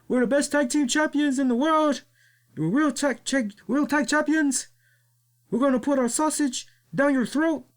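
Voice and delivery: high mock wrestler brag; imitating La Parka boasting. Mocking the tag team bragging about being champions, in a high voice. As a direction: puff up and brag in a high silly voice. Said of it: "he's like".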